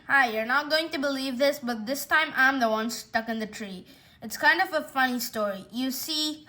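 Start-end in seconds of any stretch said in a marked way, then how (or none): none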